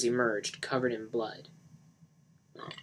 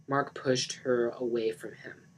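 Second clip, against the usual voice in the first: ordinary delivery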